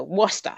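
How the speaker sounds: careful Worcester as WUSS-tuh, r's dropped; attempted New England Worcester. Shown as a demonstration. Reader retries the hard town name, Worcester as WUSS-tuh. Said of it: "let's try this again".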